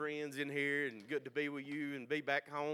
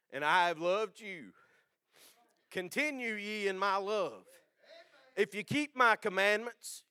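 solemn, louder Bible reading; scripture reading; 5 semitones higher, 8 dB louder than usual. Real, not acted. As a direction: read the verses with weight. Reads scripture aloud.

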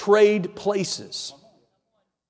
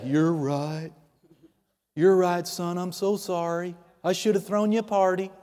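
softer, mock-apologetic father; mock apology; 3 semitones lower, 7 dB quieter than usual. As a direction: imitate a dad meekly giving in.

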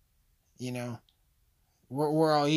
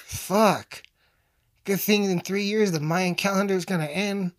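mock outrage; 5 semitones higher, 5 dB louder than usual. Comic outburst after slur.

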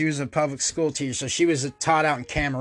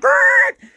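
high, loud snarling growl; a loud, high animal growl of rage; 7 dB louder than usual. Retold or imitated. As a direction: growl like a beast in fury. Stops to grab scissors in rage.

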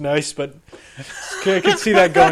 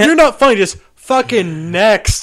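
heckler shout; 7 semitones higher, 6 dB louder than usual. Retold or imitated. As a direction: yell it at the comic. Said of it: "that's a frightening heckle".

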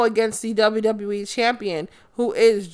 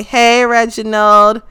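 usual speaking voice

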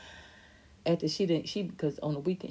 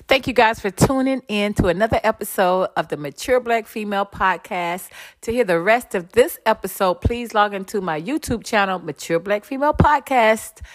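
loud, upbeat sign-off read; host signing off the podcast, loud and upbeat; podcast outro; 4 semitones higher, 13 dB louder than usual. Acted. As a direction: wrap up the show for the viewers.

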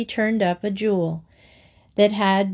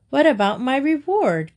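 calling out; 6 semitones higher, 3 dB louder than usual. Acted. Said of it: "called the crane".

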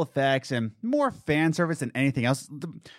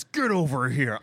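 Scorpion quote. Retold or imitated. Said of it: "when Scorpion says".

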